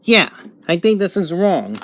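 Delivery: normal talking voice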